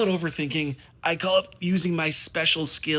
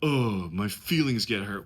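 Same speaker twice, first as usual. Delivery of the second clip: usual speaking voice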